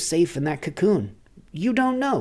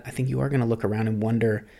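ordinary delivery

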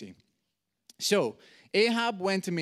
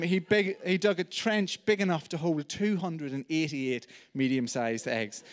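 normal talking voice

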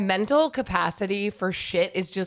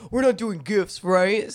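imitating John. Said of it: "You're always the guy who's like".

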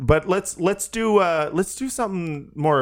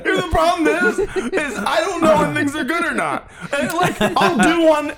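talking through real laughter, pitched up; laughing it off after a bad song; 12 semitones higher than usual. Real, not acted. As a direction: giggle out the words.

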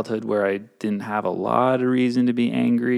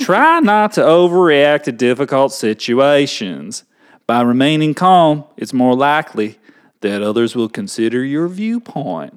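put-on Southern accent; 3 dB louder than usual. Reading fair-fighting rules to co-host, Southern accent. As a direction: recite it like a Southern adviser. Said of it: "do it in a Southern accent".

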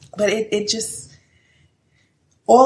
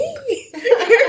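bright high laugh bursting out; high burst of laughter; 4 dB louder than usual. Real, not acted. Telling friends a funny story, laughing hard. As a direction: laugh out loud at yourself.

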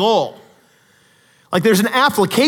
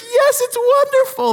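tearful thanks; 4 dB louder than usual. Retold or imitated. Stunned slum dweller in tears.

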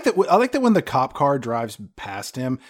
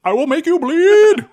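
loud, high hammy Drago threat; barbarian; 10 dB louder than usual. Retold or imitated. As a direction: growl a big villain threat. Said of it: "Ivan Drago".

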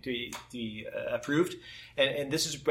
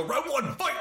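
fighting game announcer; 13 semitones higher than usual. Retold or imitated. Announcing fighting game round start.

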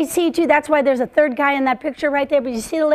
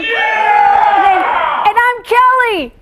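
high, loud mock-hyped shout; hammed-up cheering shout; forced cheer; 6 semitones higher, 6 dB louder, much slower than usual. Shown as a demonstration. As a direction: whip up fake crowd excitement. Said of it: "That wasn't forced at all".

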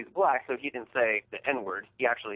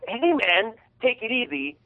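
higher voiced "Hey, man, take it easy"; imitating the truck owner; 8 semitones higher than usual. Truck owner telling the furious speaker to calm down, lightly imitated. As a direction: lightly mimic a guy trying to calm you down. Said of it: "he goes".

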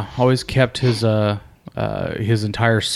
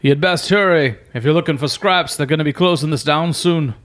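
mildly raised guard's call, pitched high; shouting down; 6 semitones higher than usual. Acted. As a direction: call down from the wall, half-shouted. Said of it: "he kinda shouts down".

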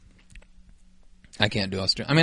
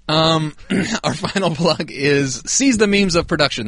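mock announcer; 4 semitones higher, slower than usual.

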